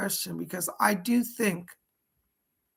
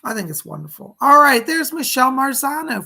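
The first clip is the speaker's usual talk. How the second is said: normal talking voice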